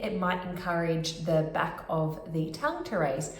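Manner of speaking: usual speaking voice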